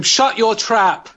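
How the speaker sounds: high, drawn-out mock yell; mock shout. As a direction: belt out the punchline slowly, word by word. Acted retort to annoying robot.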